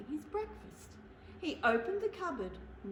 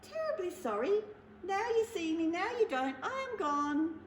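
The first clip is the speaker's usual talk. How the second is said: higher, sing-song apology; little mouse voice; 7 semitones higher than usual. Acted. The mouse apologises to Bear in a high playful voice. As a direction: play it like a magic trick.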